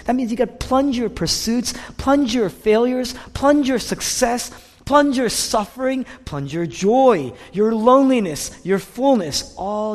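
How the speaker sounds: fervent sermon climax; building preaching. Acted. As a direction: drive the list up to a peak. Preaching at full force.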